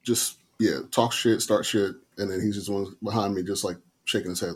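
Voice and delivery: ordinary delivery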